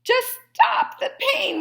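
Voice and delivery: high, slow whimpering plea. A narrator's reading. Fox whimpering to the dentist. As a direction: whimper it through the pain. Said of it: "whimpered the fox".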